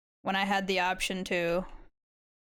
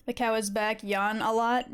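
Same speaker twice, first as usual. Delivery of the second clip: usual speaking voice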